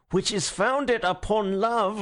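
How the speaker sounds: Gregory repeating himself through gritted teeth. Acted. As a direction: repeat it slowly, teeth clenched. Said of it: "grinding his teeth".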